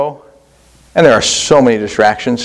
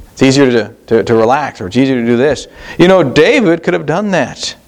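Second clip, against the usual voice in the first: usual speaking voice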